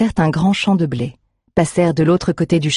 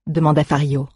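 ordinary delivery

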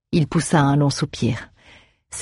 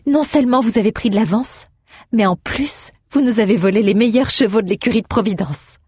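higher-pitched female character voice; reproachful actress; 6 semitones higher than usual. A narrator's reading. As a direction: lift the voice for a lively complaint. Narrator voices Kathleen.

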